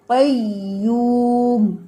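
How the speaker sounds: قیوم with strong doubled ya, drawn out; slow correct recitation; stressed shadda. Shown as a demonstration. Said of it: "اس میں آپ نے شدت لے کے آنی ہے".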